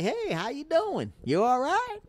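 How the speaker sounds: a high lilting Southern "how you doing"; friendly Southern greeting. Retold or imitated. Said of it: "And you're like".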